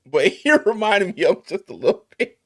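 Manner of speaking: high, loud laughing denial; laughing while backpedaling; laughing protest. Real, not acted. Laughing defending himself.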